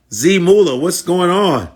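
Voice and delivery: ordinary delivery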